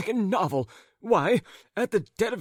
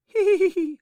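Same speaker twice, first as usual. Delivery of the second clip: much higher, loud tittering hee hee; high giggle; 7 dB louder than usual. A narrator's reading. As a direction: giggle shrilly. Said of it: "began laughing".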